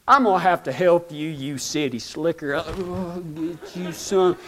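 low, soft teasing drawl-free cowboy voice; a hint of an old cowboy mocking him; old rancher friend teasing the city slicker; lightly voiced cowboy. [name] mocks him.

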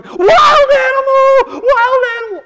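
put-on high, drawn-out kid's shriek; mock panicked yelling. Children fleeing toward their parents, hammed-up panicked yell. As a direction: scream it like terrified little kids. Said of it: "they're yelling".

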